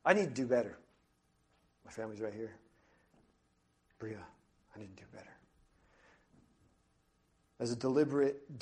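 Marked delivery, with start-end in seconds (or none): 1.8-5.4 dropped almost to nothing; hushed personal admission; near-silent confession. Real, not acted. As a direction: say it to yourself, barely audible. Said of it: "quiet confession".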